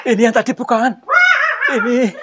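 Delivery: high, loud, laughing cry; excited shout. Real, not acted. Ghost hunter spots the figure again, excited shout. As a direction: point it out in a rush.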